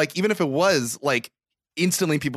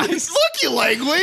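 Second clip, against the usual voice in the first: incredulous; 13 semitones higher, slower than usual. Real, not acted. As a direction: shout it in disbelief. Incredulous shout.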